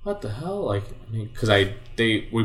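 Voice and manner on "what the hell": surprised. Retold or imitated. Retelling his shocked reaction.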